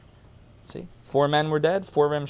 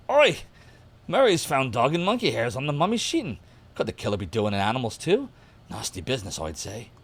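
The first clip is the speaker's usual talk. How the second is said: hammed-up British accent, punchy opening; cheeky put-on British accent; slightly better British accent; 3 semitones higher than usual. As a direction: lean into a mock British gov'nor accent. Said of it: "Let me do that again".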